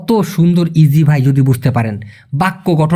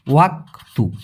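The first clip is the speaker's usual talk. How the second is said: ordinary talk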